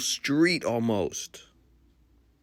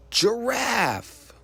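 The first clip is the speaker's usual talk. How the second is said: excited reveal; 11 semitones higher than usual. Acted. Teacher revealing the answer to children, excitedly. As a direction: jump way up high on giraffe.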